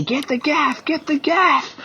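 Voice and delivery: a fisherman's mimicked scream for the gaff; retold scream. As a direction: imitate his panicked yell up high without the volume. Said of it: "He screamed at me".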